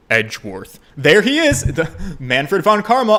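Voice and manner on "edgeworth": Edgeworth said very low and composed; low corrected take. Shown as a demonstration. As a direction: drop Edgeworth deep, with a falling end. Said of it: "Oh, I mean".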